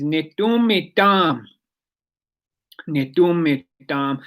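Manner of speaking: "nitumitam" said slowly, syllable by syllable; teaching "my dog" in Blackfoot, said slowly and clearly; slow Blackfoot word. Shown as a demonstration. Blackfoot teacher slowly pronouncing "nitumitam". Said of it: "the way you say "my dog" is".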